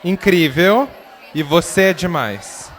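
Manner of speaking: loud rallying shout. Acted. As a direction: shout it out to the crowd. Interpreter leads the crowd, shouting.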